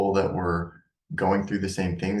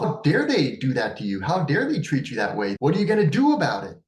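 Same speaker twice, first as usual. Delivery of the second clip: rising indignant outrage, louder and faster at the end; pissed-off parent; 5 semitones higher, 4 dB louder than usual. Acted. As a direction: work yourself up on their behalf. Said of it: "you start to get pissed off".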